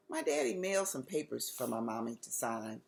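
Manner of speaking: ordinary delivery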